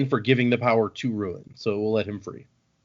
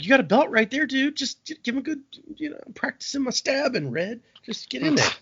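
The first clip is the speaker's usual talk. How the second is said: goading Kelsier; 12 semitones higher than usual. Retold or imitated. Kelsier goading Preservation to stab.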